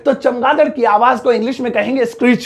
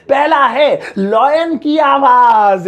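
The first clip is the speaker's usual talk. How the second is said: slow, drawn-out announcer voice, pitched higher; dramatic announcer; 4 semitones higher, much slower than usual. Acted. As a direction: announce it like a grand reveal. Dramatic intro of lion sound. (नाटकीय घोषणा वाली आवाज़)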